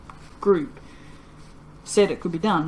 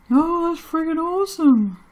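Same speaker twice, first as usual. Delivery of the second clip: high, loud burst of excitement; thrilled; 10 semitones higher, 6 dB louder than usual. Real, not acted. Soaper thrilled her soap turned gold, high excited exclamation. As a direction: let the joy burst out.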